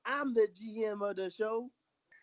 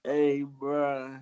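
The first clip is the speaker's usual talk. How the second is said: deep dude voice; 10 semitones lower than usual. Pretend. Low put-on voice.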